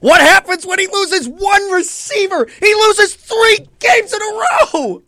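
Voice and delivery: heated shout. Real, not acted. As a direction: shout him down in anger. Heated argument with co-host.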